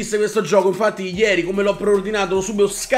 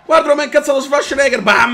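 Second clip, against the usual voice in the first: fast, high, loud excited commentary; hyped shout; 6 semitones higher, 5 dB louder than usual. Real, not acted. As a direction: rattle it off, thrilled.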